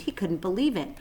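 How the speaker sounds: plain reading voice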